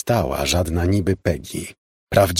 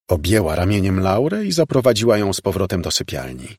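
ordinary delivery, nothing marked

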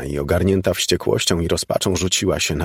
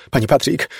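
high, urgent call; 7 semitones higher, 3 dB louder than usual. A narrator's reading. Elizabeth runs home calling Pani Patrick, calling out. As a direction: call the name urgently, pitch raised. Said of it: "wołała".

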